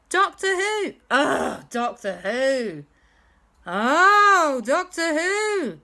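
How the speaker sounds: high, loud "oh" of realisation; impressed group cry, slow and high; dawning recognition. Acted. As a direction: draw it out as the penny drops. Group suddenly recognises the name.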